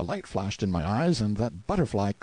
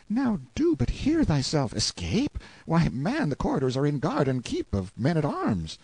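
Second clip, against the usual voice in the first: higher-pitched youthful voice, protesting; incredulous boy page; 7 semitones higher than usual. A narrator's reading. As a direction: scoff at a hopeless idea in a boy's high voice. Clarence scoffs at escape.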